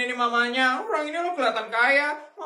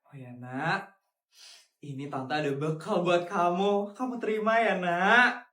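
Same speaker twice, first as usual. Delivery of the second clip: lower, gentle auntie voice; kind rich aunt; 6 semitones lower, 3 dB quieter than usual. Acted. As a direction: soothe the child like a kind aunt.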